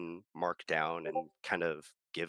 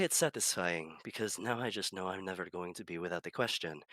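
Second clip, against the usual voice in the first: normal talking voice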